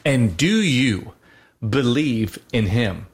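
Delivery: slow, measured intoning of the rite's question; solemn liturgical question. Retold or imitated. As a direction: ask it slowly and gravely, like a priest at a baptism. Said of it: "this last statement that says".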